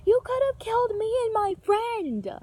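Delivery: high, loud enraged yell at the driver; furious yell. Retold or imitated. Yelling at the driver who nearly hit her. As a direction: scream it at the old driver. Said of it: "everyone just sees me like".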